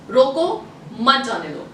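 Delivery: "रोको", stop, then "मत जाने दो"; pause after रोको. Shown as a demonstration. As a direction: break right after रोको, slowly. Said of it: "अभी कॉमा चेंज करते हैं".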